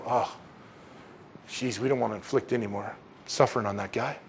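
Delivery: soft, low, reluctant murmur of a crowd; imagined crowd relenting. Retold or imitated. Pilate expects the crowd to relent at the sight, soft and reluctant. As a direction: back off quietly, as a crowd taking pity. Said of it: "the crowd is gonna go".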